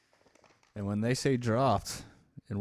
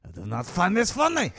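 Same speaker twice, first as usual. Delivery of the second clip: put-on French accent; 9 semitones higher, 8 dB louder than usual. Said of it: "nor do I talk like that".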